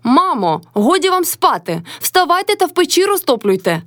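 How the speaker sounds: high, mocking echo of an order; shouting back. A narrator's reading. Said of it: "крикнула й собі Мотря з полу".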